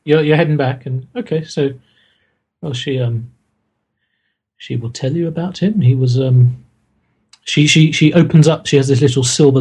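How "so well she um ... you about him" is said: normal talking voice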